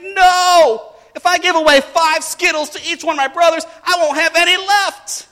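put-on very high, loud kid outburst; imitating an outraged child; protesting daughter. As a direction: wail it like a girl mid-tantrum. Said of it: "And she's like".

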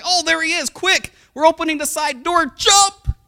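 high, loud mock-frantic command; urgent calling out. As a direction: hustle your kid out of the car, loudly. Parents urgently hurry son out.

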